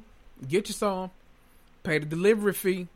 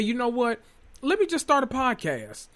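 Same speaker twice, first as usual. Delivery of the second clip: higher-pitched imitation of someone's bright idea; voicing a quote; 7 semitones higher than usual. Host mimicking cast member deciding to start a podcast, higher-pitched quote. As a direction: mimic her making a sudden decision, pitched up.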